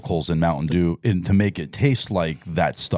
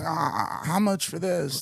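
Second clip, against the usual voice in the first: high, softer mock seller; pawn shop customer; 11 semitones higher, 7 dB quieter, slower than usual. Imagined thief asking a pawnbroker for money, mock customer voice. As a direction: pitch it up as a hopeful junkie. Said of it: "coming to a pawn shop".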